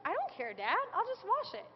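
put-on high, carefree kid voice brushing it off; high mimicked child shrugging off a scolding; sassy little girl. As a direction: play the unbothered four-year-old talking back to Dad. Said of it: "she just looks at him and goes".

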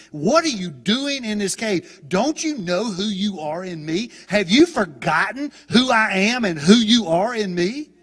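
loud, high preacher's rebuke, rising to a peak; rebuking God. Retold or imitated. Preacher voicing God confronting Elijah in the cave, rebuking. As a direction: challenge him with slow, forceful questions. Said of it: "after he rebukes him".

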